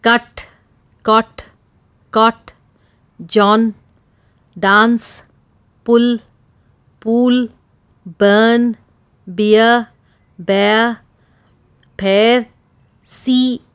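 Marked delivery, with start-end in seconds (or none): none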